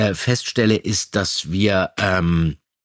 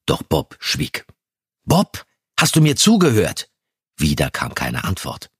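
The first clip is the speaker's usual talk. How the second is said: dramatic scene reading. Acted. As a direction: act out the scene like a radio play. Die fängt nämlich mit einer szenischen Lesung an. (szenische Lesung mit verstellten Stimmen)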